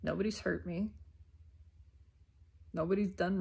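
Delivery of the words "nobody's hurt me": usual speaking voice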